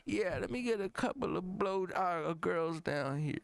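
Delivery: high, dragging mock rapper voice; hesitant high-pitched star parody. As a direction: accept the offer in a high put-on voice. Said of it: "Jay-Z?".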